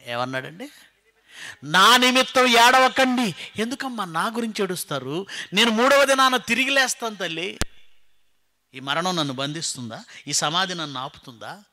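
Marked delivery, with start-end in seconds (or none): 1.6-7.6 high, loud preached quote; emphatic exhortation. Acted. Jesus telling the women not to weep, high emphatic exhortation. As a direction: insist it to the women, stretching the command. Voices Jesus forbidding weeping for him.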